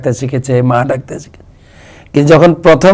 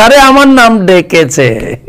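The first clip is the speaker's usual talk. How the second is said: higher, slow, overjoyed grandmother; delighted grandma; 8 semitones higher, slower than usual. Retold or imitated. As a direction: gush like a grandma thrilled the baby called her. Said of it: "দ বললে দিদা বলে".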